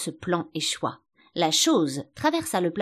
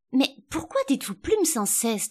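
higher-pitched, mildly vexed question; rather vexed; 7 semitones higher than usual. A narrator's reading. Alice questions the sheep's repeated cry, rather vexed. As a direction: ask with a touch of annoyance. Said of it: "plutôt vexée".

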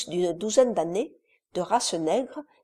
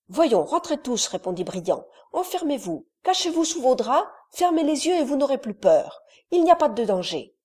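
raised but soothing orders; calm the little ones, tell them to hide; reassuring older boy; 5 semitones higher, 4 dB louder than usual. A narrator's reading. Briand sends the frightened children to bed, reassuring.

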